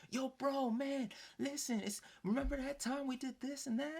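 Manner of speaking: high, excited mock reminiscing; fake old pal. Faking shared memories with stranger.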